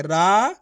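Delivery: रा with the aa held long; stretched syllable. Shown as a demonstration. Teacher sounding out Raju, रा stretched long. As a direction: draw out the vowel of रा. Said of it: "रा का उच्चारण खेच को रहे".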